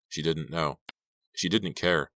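ordinary delivery, nothing marked

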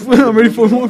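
laughing while echoing it; chuckling echo. Retold or imitated. Friend repeats line laughing.

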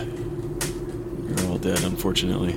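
quiet, slow, breathy delivery; hushed and quiet. Acted. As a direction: gently break the bad news. Telling ghosts they are dead.

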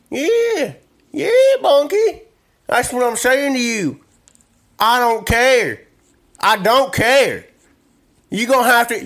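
loud, high-pitched country blowhard; Rufus Rodney. Acted. Country man bosses Bunky about Old Bay, high and loud. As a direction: holler like an insistent backwoods character.

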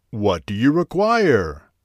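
slow, loud grand genie. Acted. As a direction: boom it slowly like a great spirit. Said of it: "asked the genie".

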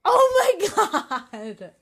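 loud, high, long-drawn exclamation; amused, exasperated outburst; laughing exasperation. Real, not acted. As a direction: stretch it out loudly through a laugh. Exasperated by partner's teasing.

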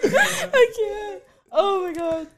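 high wheezing laughter; can't breathe laughing. Real, not acted.

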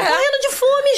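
high-pitched lament; exasperated emphasis. Real, not acted. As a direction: complain dramatically to the listeners. Emphasising hunger in the retelling.

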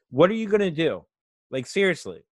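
usual speaking voice

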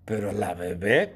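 ordinary talk

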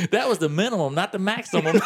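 mock-correcting contrast on "minimum" and "maximum"; indignant correction. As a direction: stress "minimum" against "maximum". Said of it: "Bernie was hot".

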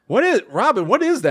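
baffled Howard. Retold or imitated. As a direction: imitate a host who can't believe his eyes. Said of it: "he's going like".

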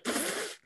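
soft puff of air through the lips; mock dismissive pfft. Jenna brushing off attempted compulsion, dismissive puff. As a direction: blow off the idea with a quiet pfft.

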